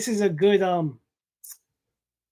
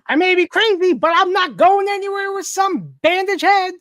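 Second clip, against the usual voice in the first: high, loud cartoon villain; high-pitched villain voice, pushed hard; Joker; 10 semitones higher, 5 dB louder than usual. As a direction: crank it up high into a manic comic-book villain. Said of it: "I can't do a Joker voice".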